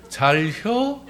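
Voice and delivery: high, rising Chungcheong question; Chungcheong dialect. Shown as a demonstration. Congregation told to greet neighbours this way, Chungcheong accent. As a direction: swoop up like a Chungcheong local.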